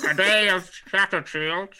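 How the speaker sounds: joining in with a mock radio king voice; crackly radio voice. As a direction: imitate an old wireless speech. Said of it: "Talking through, like, a crackly microphone".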